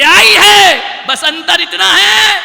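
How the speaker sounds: loud, high, slow declaration; booming reply. Acted. Yamraj answering the seth, slow loud proclamation. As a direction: proclaim it with weight.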